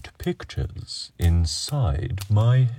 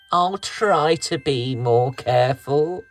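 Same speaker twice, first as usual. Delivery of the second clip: put-on sheepish boy; 5 semitones higher than usual. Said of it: "sheepish grin".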